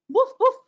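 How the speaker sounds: high, loud barking woof; mock dog bark. Mouse fakes a dog to scare the cat, high loud woof.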